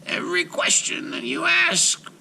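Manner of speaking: high, slow cackling witch; old witch voice. A narrator's reading. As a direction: warn her like a crooked old hag. Baba Yaga voiced.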